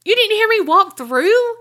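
high-pitched rising disbelief; incredulous question. Retold or imitated. Asking Carrie whether she heard her walk through, incredulous. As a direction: act amazed she missed it.

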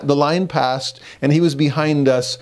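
ordinary talk

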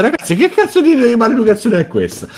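high, fast mock indignation; hammed-up scolding of friends; mock outrage.

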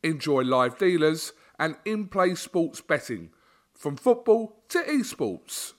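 hyped betting ad. Acted. Sponsor read for casino.

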